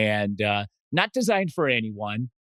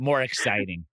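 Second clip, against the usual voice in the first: ordinary delivery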